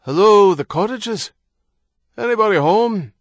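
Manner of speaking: high, loud hail from below; calling out loudly. Acted. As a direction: call up to the cottages. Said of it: "Harrody called out loudly from below".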